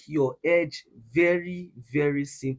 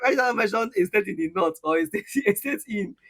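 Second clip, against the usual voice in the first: higher, louder, amused delivery broken by laughs; laughing; 5 semitones higher, 5 dB louder than usual. Real, not acted. Mentor teasing a trainee about his home state, laughing. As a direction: laugh your way through the teasing.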